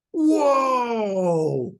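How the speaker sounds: high, loud amazed cry of whoa; the kids gasping in awe at the roadblock; kids' whoa. Retold or imitated. Kids in the back seat wowed by the guard, loud high cry. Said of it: "They're, like, going".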